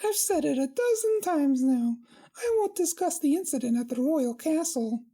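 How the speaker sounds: squeaky high princess voice, friendly; high pony princess. Acted. Twilight voiced in the reading.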